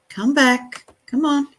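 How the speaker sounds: ordinary talk, nothing marked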